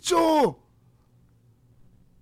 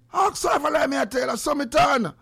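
high, raised voice; loud appeal; 7 semitones higher, 3 dB louder than usual. Real, not acted. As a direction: insist that she can back you up. Loudly calls wife as witness.

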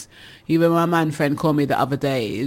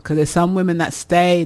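ordinary delivery

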